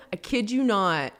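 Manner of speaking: usual speaking voice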